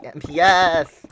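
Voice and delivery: loud, slow drawn-out shout; excited shout. Real, not acted. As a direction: yell the letters out. Gaming vlog, excited shout.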